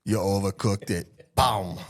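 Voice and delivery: lightly put-on Austrian accent; Arnold Schwarzenegger. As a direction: killer robot's verdict in mock Austrian. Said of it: "Austrian Arnold Schwarzenegger voice".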